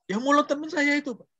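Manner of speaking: pitched well up as the student, a touch whiny. Retold or imitated. Lecturer voicing a hurt student answering him, high and whiny. Student voiced in retold dialogue.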